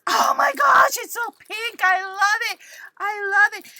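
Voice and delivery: high, loud burst of delight; gushing squeal of joy; excited squeal. Real, not acted. Crafter seeing the pink gift, excited squeal.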